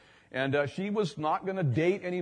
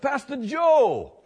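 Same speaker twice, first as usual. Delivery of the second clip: much higher, louder, slow delighted call; excited man greeting; 11 semitones higher, 8 dB louder, much slower than usual. Retold or imitated. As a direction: call out the pastor's name high and slow, thrilled. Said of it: "and he said".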